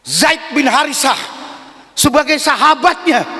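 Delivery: ordinary delivery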